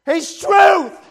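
loud proclaiming "He's truth"; shouted. Real, not acted. Quiet then shouted repeat.

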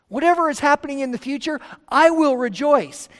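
a preacher's rising, fast declaration; fervent declaration. Retold or imitated. Paul's resolve voiced with fervor.

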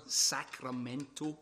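Sacramento drawn out slowly, every syllable relished; savouring the word. Shown as a demonstration. A Scotsman enjoying saying Sacramento, slowly. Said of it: "is a great word to say".

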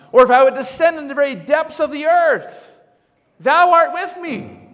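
high, loud, ringing psalm quotation; declaimed scripture. Acted. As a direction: proclaim it to the back pews. Declaims a psalm at full force.

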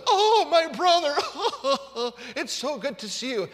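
high, drawn-out, hammed-up joyful sob; comic blubbering with laughing sobs; mock happy weeping. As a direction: wail a tearful reunion greeting, playfully overdone. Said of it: "you wonder why Jacob was weeping".

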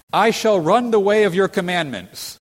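high, loud, ringing vow; confident declaration. Acted. Preacher voicing the psalmist's vow, bold and confident. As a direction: proclaim it with bold resolve.

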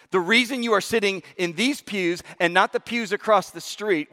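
ordinary delivery

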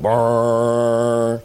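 low buzzing brrr like a phone dial tone. Retold or imitated. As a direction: hum the dead line. Imitates a dial tone.

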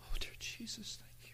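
a hushed, overcome whisper to Jesus; whispered prayer. Real, not acted. Preacher thanking Jesus mid-sermon: whispered prayer. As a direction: pray it under your breath.

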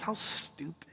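whispered sneer; a teen whispering a put-down; catty whisper. Retold or imitated. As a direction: whisper it behind a hand. Imitating gossiping high schoolers.

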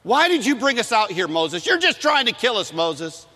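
high, loud accusing whine; whiny complaint. Retold or imitated. People grumbling at Moses, high loud whine. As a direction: throw the blame at Moses. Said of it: "They're complaining".

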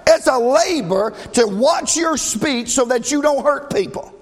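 high, loud preacher's cadence; fiery preaching. Acted. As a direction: preach it out to the congregation. Preaching at full force.

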